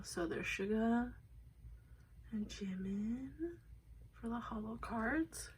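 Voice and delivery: quietly whispered card run-down; hushed voice. Real, not acted. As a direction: murmur the names as if not to disturb. Hushed naming of cards.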